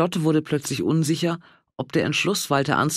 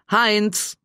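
raised call for her husband; 7 semitones higher than usual. A narrator's reading. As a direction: call his name across the house. Ruft nach Heinz im Garten. (Ehefrau ruft ihren Mann im Garten, laut rufend)

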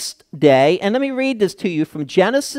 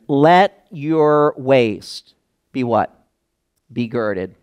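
slow drawn-out reading; 4 semitones lower, slower than usual. Acted. Slow reading, prompting congregation.

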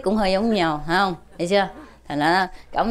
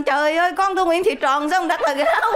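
higher, laughing through the cry of dismay; laughing mock protest; 9 semitones higher, faster than usual. Grieving mother scolds the teacher, higher, laughing. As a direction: wail it while cracking up.

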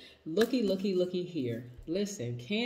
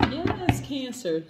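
excited; 8 semitones higher, 7 dB louder, much slower than usual. Real, not acted. Drawn-out hyped call to sign.

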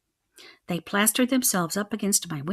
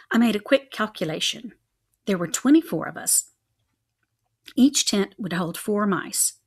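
plain reading voice

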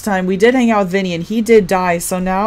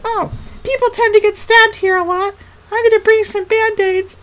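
much higher, put-on sweet voice; mock Jeremy voice. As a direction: squeak it out as the shy boy. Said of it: "He was like".

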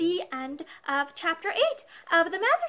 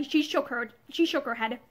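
usual speaking voice

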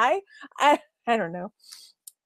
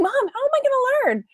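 much higher, exasperated whine; whiny high voice; 13 semitones higher, 4 dB quieter than usual. Retold or imitated. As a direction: complain like a frustrated kid. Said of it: "I'm like".

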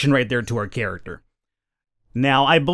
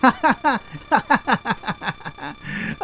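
haughty laugh; 8 semitones higher than usual. Pretend. Snobby rival mocks the heroine.

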